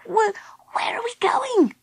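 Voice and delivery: very high, wobbly little question; stammering, high small-creature whimper; tearful. A narrator's reading. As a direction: ask through tears in a tiny voice. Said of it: "as he wiped away his tears".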